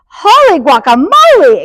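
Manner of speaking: high, loud exclamation of surprise; exclaiming. A narrator's reading. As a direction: cry out in storybook amazement. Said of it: "exclaimed Skippy John Jones".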